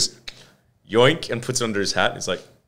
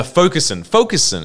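ad announcer voice; 6 semitones higher, 4 dB louder than usual. Retold or imitated. Mimics drug ad name.

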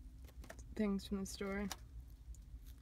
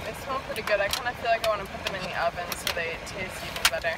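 loud; 9 dB louder than usual. Real, not acted. Loud presenter voice.

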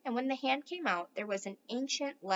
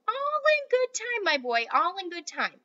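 teasing grandpa; 10 semitones higher, 6 dB louder than usual. Acted.

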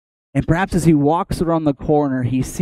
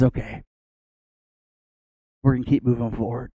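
softer, quietly accepting reply; gentle relenting; 3 semitones lower, 6 dB quieter, faster than usual. Acted. God answering Moses's plea, soft gentle relenting. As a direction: act out a quiet concession.

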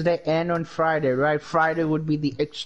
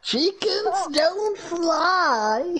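squeaky chick voice; 13 semitones higher, much slower than usual. Pretend. Mocking chick voice.

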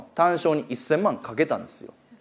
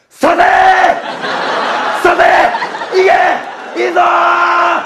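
imitating an old man's cheer, much higher and louder; old man's shout; 10 semitones higher, 6 dB louder than usual. Old man beside him roaring for Take's horse, mock loud shout. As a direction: ham up a rival punter roaring his horse on, drawing out the last word. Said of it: "って言ってるんですよ".

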